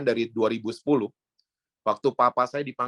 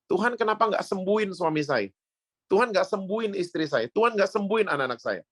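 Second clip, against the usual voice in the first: mock angry complaint; 7 semitones higher than usual. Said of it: "kita marah".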